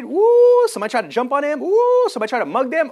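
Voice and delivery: high, loud ooh-ing build; rising thrill at the fight; excited hype. Acted. Hyped imagining kung fu fight.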